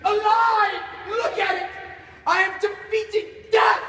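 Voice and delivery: high, loud exultant shouting; manic triumph. Acted. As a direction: proclaim victory over death at full voice. Scientist exults over his creation.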